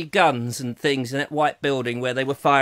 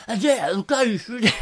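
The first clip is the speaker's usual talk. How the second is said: higher-pitched naturalist impression; David Attenborough; 4 semitones higher than usual. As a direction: narrate like a wildlife presenter. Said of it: "Attenborough, isn't it?".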